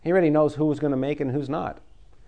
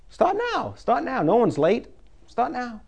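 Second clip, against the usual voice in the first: urging; 7 semitones higher than usual. Real, not acted. Preacher urging the congregation.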